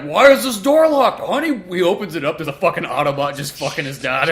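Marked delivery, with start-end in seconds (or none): 0.0-1.6 suspicious dad. Retold or imitated. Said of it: "[name] opens the door like".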